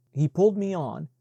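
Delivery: ordinary delivery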